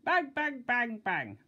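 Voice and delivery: loud, high, hammered bangs; explosive knocking noise; pounding knocks. A narrator's reading. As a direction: pound each bang like a fist on a door. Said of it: "a noise which sounded like bombs".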